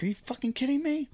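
the guy who came late, finding all the food gone, shouting angrily; angry outburst. Retold or imitated. Retold outburst, pitched high. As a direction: pitch jumps way up on a furious retort.

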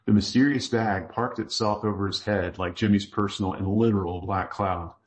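usual speaking voice

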